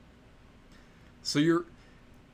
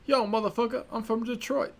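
Eddie Murphy impression; 10 semitones higher than usual. Telling how Eddie Murphy talks in Beverly Hills Cop, brash high swagger. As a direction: bark it out like a brash Detroit cop. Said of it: "He's like".

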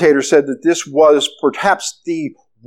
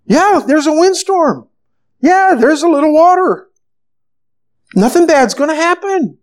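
high-pitched, mildly exasperated questioning; incredulous chiding; 9 semitones higher than usual. Acted. Jesus asking disciples why they are afraid, incredulous. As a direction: pitch it high, puzzled at all the fuss.

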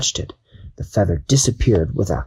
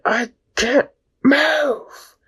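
much higher, drawn-out panicked cry; snake yelling; much slower than usual. Acted. Snake stuck in a knot, high panicked cry. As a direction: wail in frustration. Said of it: "And as he yelled".

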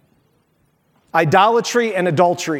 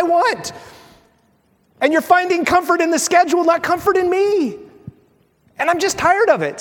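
a lightly voiced God, higher, fed up and frank; 8 semitones higher than usual. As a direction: play God explaining why he interrupts, a touch weary. God answers the complaining believer.

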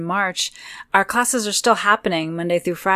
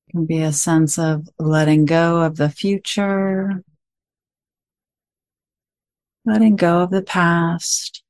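guided meditation; 7 dB louder than usual. Acted. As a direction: guide listeners gently into stillness, phrase by phrase.

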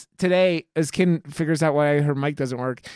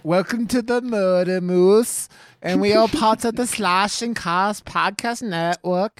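slow, raised announcer voice; hammed-up show intro; 5 semitones higher, 5 dB louder, slower than usual. Host welcoming listeners to the podcast, hammed-up announcer. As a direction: draw out a big mock-formal welcome.

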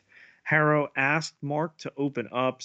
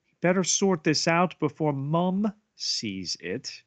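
put-on British accent; 6 semitones higher, 3 dB louder than usual. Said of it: "in a British accent here".

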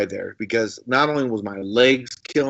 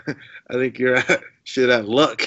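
put-on laughing Kiwi accent. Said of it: "they have these weird accents".

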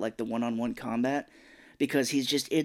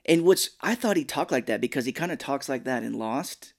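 normal talking voice

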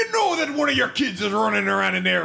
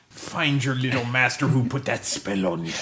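normal talking voice

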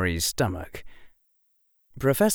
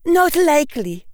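high, firm schoolmistress; stern witch, final word; 5 dB louder than usual. Acted. McGonagall firm.

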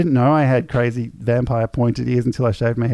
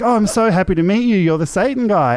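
excited; 9 semitones higher, 4 dB louder than usual. Retold or imitated. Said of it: "He's like".

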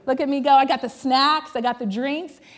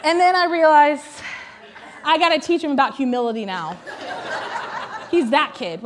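laughing. Real, not acted.

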